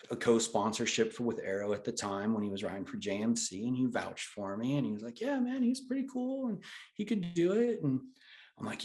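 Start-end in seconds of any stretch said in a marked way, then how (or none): 5.2-7.8 much higher, light mock voice of a buddy vouching; buddy vouching for him, done in a high put-on voice; imitating a buddy. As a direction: play the easygoing friend, pitched up. Voicing Andy vouching for him.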